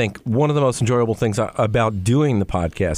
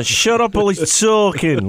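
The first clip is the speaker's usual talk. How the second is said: a hammed-up British accent, slow and higher; bad British accent tossed off as a joke; cheeky mock-British line, higher and slow; 7 semitones higher, slower than usual. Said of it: "dare to do a British accent".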